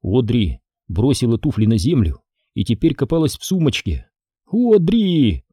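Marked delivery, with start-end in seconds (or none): 4.5-5.4 high sharp scream of a name; Jenny yelling at Audrey by the car; shout. Acted. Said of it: "закричала Дженни".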